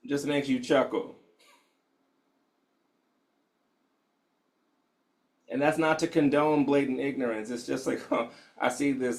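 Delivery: hushed, unhurried guide settling the room; guided meditation. Acted. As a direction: ease them into stillness gently.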